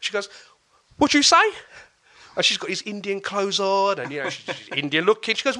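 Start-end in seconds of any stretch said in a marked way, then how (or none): 1.0-1.4 much higher and louder, squaring up; a tiny fierce woman daring them to repeat it; confrontational challenge. Retold or imitated. Mum challenges the skinheads, loud high furious challenge.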